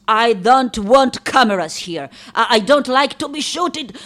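loud, high objection; loud, annoyed refusal; indignant protest. Acted. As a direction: push the cameras away with your voice. Objects to cameras.

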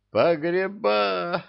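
high, slow joyful cry; jubilant devil. A narrator's reading. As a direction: rejoice at the find, drawn out. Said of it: "возликовал дьявол". (ликующий возглас)

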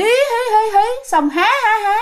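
high hee-hee turning to ha-ha; imitated ghost laugh. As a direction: shift the mock cackle from hí to há. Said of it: "Bà nói bà cười từ hí". (giả tiếng cười ma the thé)

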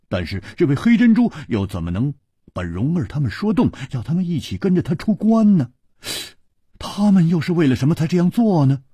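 a bold hero who can't hold back his questions; eager young swordsman. Acted. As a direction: press him hard with excited curiosity. High lively voice for 胡铁花.

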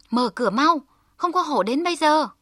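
high, loud shout through the door; loud call. A narrator's reading. Hơ Lao shouts to the locked door as taught: loud high call. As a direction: yell the code words at the door. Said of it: "chàng kêu to".

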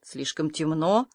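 low, soft, slow questioning repeat; incredulous echo. Acted. Marilla doubts Anya's excuse, incredulous.

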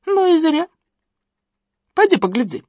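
teasing granny. A narrator's reading. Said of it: "подначивала бабуля".

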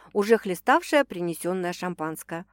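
ordinary delivery, nothing marked

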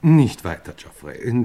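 loud, cutting him off; sharp interruption. Acted. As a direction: stop him mid-sentence. Uncle cuts nephew off abruptly.

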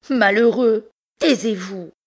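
high, loud furious command to be silent; angry outburst. Acted. Bertrande angrily orders Pierre to be silent, high and loud. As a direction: cut him off in anger.

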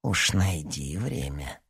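slow, soft menace; quietly threatening insistence; ominous tone. A narrator's reading. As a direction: press softly and slowly with a hidden threat. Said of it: "Тон Бажина не предвещал ничего хорошего".

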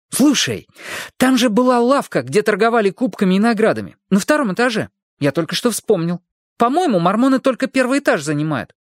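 put-on an excited boy who just remembered; blurting boy. A narrator's reading. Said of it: "Хилли выпалил".

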